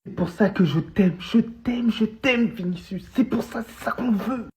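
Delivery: high, gushing declaration of love; overflowing with joy for his idol; euphoric. Real, not acted. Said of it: "je suis en euphorie". (euphorique, voix qui monte)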